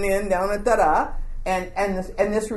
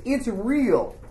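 ordinary talk

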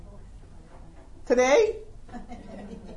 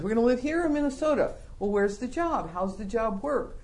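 usual speaking voice

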